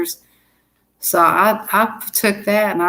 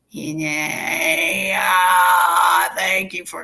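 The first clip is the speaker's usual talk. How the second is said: mock voicemail greeting, stretched out; put-on answering machine voice; fake answering message; much slower than usual. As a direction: pretend to be a recorded message. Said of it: "I'll put it on mute and I'll say".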